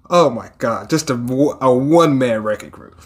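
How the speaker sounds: ordinary talk, nothing marked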